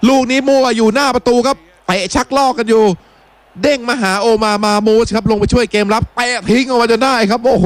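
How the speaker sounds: urgent running football commentary; live football play-by-play. Real, not acted. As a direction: call the attack as it unfolds, pitch up.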